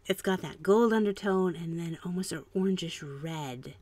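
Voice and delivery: normal talking voice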